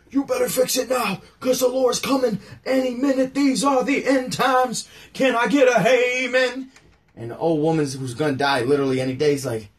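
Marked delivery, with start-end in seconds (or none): 0.1-6.7 high, loud shouting preacher; hammered-up doomsday sermon; mocking a pastor yelling at his flock; end-times pastor. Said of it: "Ezekiel was that end of days pastor".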